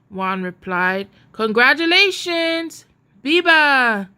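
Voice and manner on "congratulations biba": exclaimed. A narrator's reading. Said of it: "Tun Pedro exclaimed".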